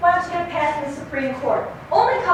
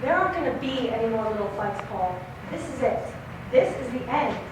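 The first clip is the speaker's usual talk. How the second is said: quiet finality; 6 semitones lower, 9 dB quieter than usual. Acted. Wife quietly telling her husband the marriage is over. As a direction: lower and much softer, resigned.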